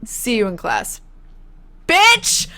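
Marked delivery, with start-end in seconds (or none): 1.9-2.5 high, loud snapping insult; shouted insult. Acted. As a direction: fling the word like a teacher losing it. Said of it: "a teacher just called a student bitch".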